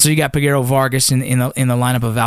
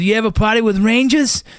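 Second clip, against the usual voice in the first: much higher mock Boston accent; 9 semitones higher than usual. Buddy from Boston hitting on girls, imitated Boston accent. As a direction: imitate a Boston guy's pickup line, pitched up. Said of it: "he's from, like, Boston or something".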